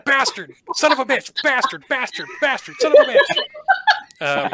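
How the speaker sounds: very high, loud squealing curses; chittering creature insults. Acted. Caged imps cursing the intruder, loud high squeal. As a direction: squeal curses like a frenzied imp. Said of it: "they're saying things like".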